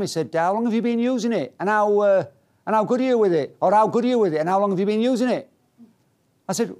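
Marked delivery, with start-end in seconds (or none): 0.3-5.5 higher-pitched, inquisitive Yorkshire instructor; broad Yorkshire accent. Retold or imitated. Instructor quizzes pupil on the arm, imitated broad Yorkshire accent. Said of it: "in this broad Yorkshire accent".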